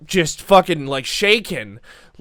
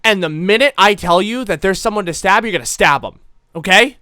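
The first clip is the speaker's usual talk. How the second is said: loud, emphatic coaching; forceful order; 4 semitones higher, 7 dB louder than usual. Acted. Tough woman instructing Bill to stab, loud and firm. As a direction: drill the instruction into the kid.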